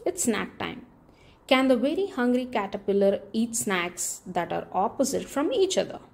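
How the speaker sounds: usual speaking voice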